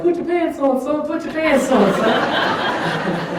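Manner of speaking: high, loud mock dad voice; mock husband yelling at son; husband's command. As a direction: bark it like a fed-up husband, hammed up. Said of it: "my husband's back on like".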